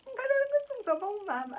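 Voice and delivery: hammed-up tearful squeal; mock crying. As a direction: wail it out like a crybaby.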